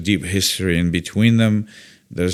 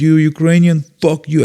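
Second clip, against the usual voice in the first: raised, louder mock-angry voice; retold hostile outburst; 7 semitones higher, 7 dB louder than usual. Stranger confronting the Ukrainian buddy, angry and loud. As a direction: imitate an aggressive stranger, high and loud.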